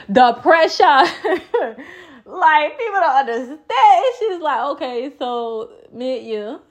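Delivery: high, loud laughing through the words. Real, not acted. Telling viewers about brunch pressure, high and laughing.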